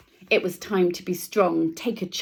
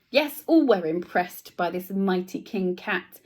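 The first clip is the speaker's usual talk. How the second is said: usual speaking voice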